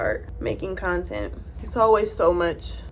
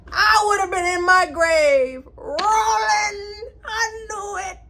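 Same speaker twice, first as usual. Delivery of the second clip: high, drawn-out hammed-up wail; half-crying, half-yelling in mock despair; 10 semitones higher, slower than usual. Reacting to dad dating mom's friend, hammed-up wailing. Imagining learning of dad's affair.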